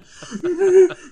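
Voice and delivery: high, loud comic wail; hammed-up sobbing noise; mock crying. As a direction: blubber like a wailing kid. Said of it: "fucking crying".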